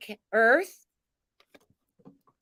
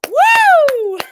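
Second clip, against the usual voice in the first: high loud woo; 13 dB louder than usual. Real, not acted.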